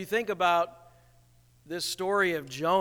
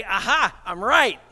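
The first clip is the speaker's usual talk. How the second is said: smug gloat; 3 semitones higher, 7 dB louder than usual. Retold or imitated. Smug reader quoting himself.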